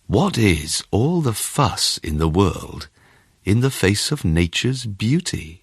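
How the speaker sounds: usual speaking voice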